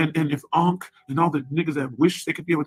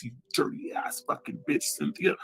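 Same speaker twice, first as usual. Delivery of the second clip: voiceless, much softer whisper; whispered insult; 9 dB quieter than usual. Real, not acted. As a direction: hiss the insult under your breath. Insult ending a rant.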